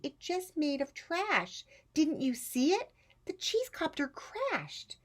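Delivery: high, put-on little-girl voice; Rosie's small voice. Rosie telling her aunt she failed, high child voice. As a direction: sound like a crestfallen child. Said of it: "said dear Rosie".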